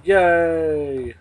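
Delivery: cheering. Pretend. Cheering a performance.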